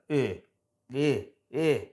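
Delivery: short, separate "Uh" grunts; lazy, barely formed "Uh", repeated; lazy schwa. Shown as a demonstration. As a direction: say "Uh" as a relaxed schwa. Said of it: "in a really lazy way".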